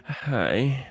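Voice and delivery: hoarse from a cold; sick voice. Real, not acted. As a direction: croak it out sick. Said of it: "let them hear your sick voice".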